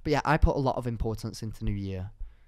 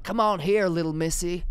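put-on old man voice; 5 semitones higher, 3 dB louder than usual. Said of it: "this old man went".

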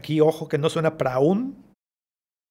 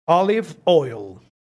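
slow, Olive stressed, said aliv; slow model; 4 dB louder, much slower than usual. Shown as a demonstration. Teacher slowly models Olive oil for students. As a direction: lean on Olive, let oil fall. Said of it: "Aceite de oliva".

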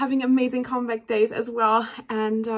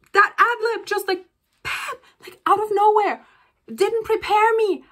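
1 excited gushing; 8 semitones higher, 3 dB louder than usual. Real, not acted. Fan reliving the surprise ad lib.

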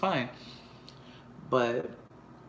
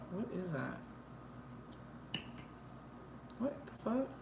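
hushed; 13 dB quieter than usual. Real, not acted. Sleeping child nearby, speaking low.

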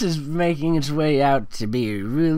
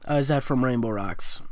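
ordinary talk

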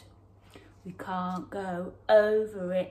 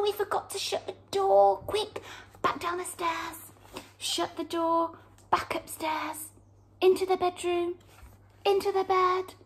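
panicky rush; 9 semitones higher, faster than usual. Acted. Running from the bear, mock panic. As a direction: race through it out of breath.

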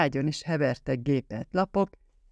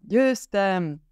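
triumphant cry; 5 semitones higher, 3 dB louder than usual. A narrator's reading. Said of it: "ugrálva örömére".

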